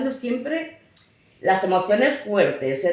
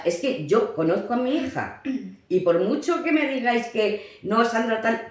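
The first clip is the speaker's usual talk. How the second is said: usual speaking voice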